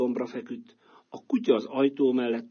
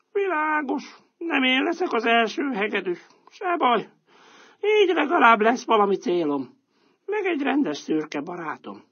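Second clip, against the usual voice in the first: higher, resigned but content; cat's voice; 13 semitones higher, 4 dB louder than usual. Acted. Old cat agreeing to join the donkey, higher and resigned.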